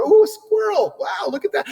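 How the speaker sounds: sudden high-pitched squirrel excitement; distracted delight. The guy ignoring his friend at the table, suddenly excited. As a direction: jump up in pitch like a distracted kid.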